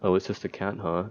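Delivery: ordinary talk, nothing marked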